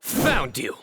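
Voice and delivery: mild shout on catching someone; triumphant call-out. Acted. Investigator to hidden culprit, triumphant call-out.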